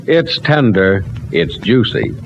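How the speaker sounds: ordinary talk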